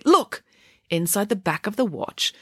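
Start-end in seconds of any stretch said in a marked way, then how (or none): 0.0-0.4 much higher, louder call to look; urgent cry. A narrator's reading. Girl shows the hidden paper, excited cry. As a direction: point them all at it, excited. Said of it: "cried Friday".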